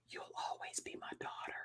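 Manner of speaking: tender, barely audible whisper; dying whisper. Retold or imitated. Dying dad's last words to his daughter, imitated whisper. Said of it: "he was whispering".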